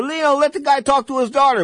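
high, pleading boxer's voice. Retold or imitated. Camacho asking the ex-wife to let him talk to his daughter, pleading high. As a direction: lift it up and lean on her kindly. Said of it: "he told her".

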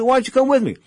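a boss's breezy invitation; Don King voice. Retold or imitated. Don King inviting Pedro along, in a high hammed-up voice. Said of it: "he said".

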